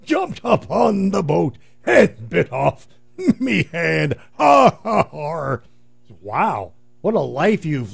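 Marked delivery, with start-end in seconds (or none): 0.0-5.6 high slow pirate with a laughing shout. Retold or imitated. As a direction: growl it out like a salty pirate, then cackle. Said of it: "So what happened".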